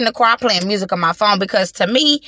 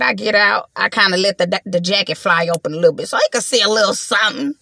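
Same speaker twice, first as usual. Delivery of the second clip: ordinary delivery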